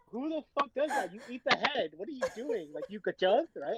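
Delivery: put-on high-pitched scolding older vendor voice; mock Vietnamese auntie. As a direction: play a scandalised street vendor. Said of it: "And she's like".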